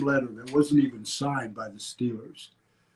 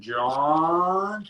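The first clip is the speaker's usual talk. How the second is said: put-on a slow, long-stretched old man's John; drawn-out John; 3 semitones higher than usual. Old team owner on the phone, slowly drawing out John. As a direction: lightly mimic the old owner stretching the name. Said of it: "he could take five minutes just saying".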